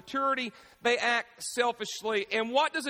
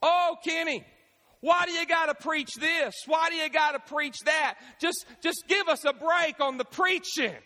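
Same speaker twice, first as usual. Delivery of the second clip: whiny complainer; 6 semitones higher than usual. Retold or imitated. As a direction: whine at the pastor like a child. Member complaining to pastor Kenny.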